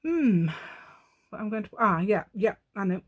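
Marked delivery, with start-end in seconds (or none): none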